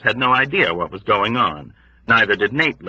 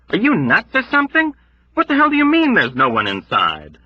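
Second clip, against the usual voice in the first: shouting; 11 semitones higher than usual. Acted. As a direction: shout it in horror. Yossarian shouting in dread.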